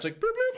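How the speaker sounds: very high, cartoonish bloop bloop; squeaky little bloop noises; bloop sound effect. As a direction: pop it out like a toy hatch opening. Hatch popping open sound effect.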